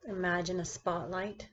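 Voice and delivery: measured meditation-guide delivery with long pauses between phrases; guided meditation. Acted. Teacher guiding her class through seated meditation, guided meditation voice. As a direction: lead the listener into stillness, one phrase at a time.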